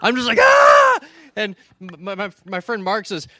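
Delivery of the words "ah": very high, loud mock scream; girlish scream. Retelling himself shooting from the tent, screaming like a girl. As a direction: shriek it out in comic terror. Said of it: "I start screaming like a girl".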